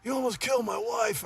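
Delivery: playing the furious old golfer catching the culprit; angry old man. Retold golfer's anger from the old man, mock angry yell. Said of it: "And he goes".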